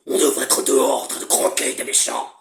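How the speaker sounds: low, unvoiced creature rasp; Vénine voice. Shown as a demonstration. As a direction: growl it like a monster. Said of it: "Regardez, Vénine".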